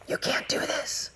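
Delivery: hushed, breathy voice of self-doubt; inner villain whisper. Acted. The inner villain of self-doubt taunting, hushed whisper. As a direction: whisper it like a sneaky voice in your ear. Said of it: "I call it the inner villain".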